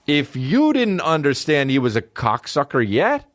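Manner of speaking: ordinary talk, nothing marked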